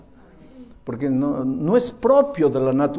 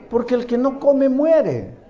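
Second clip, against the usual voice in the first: a hint of a firm mother, slow and higher; mother's answer; 8 semitones higher, 4 dB louder, slower than usual. A mother telling her son why he must eat, slow and firm. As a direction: lay down the rule slowly like a mom.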